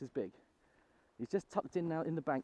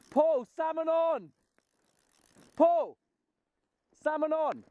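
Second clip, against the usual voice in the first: loud long call, high and stretched; shouting across the river; 14 dB louder, slower than usual. Real, not acted. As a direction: yell out to a friend far away. Calling out to distant friend.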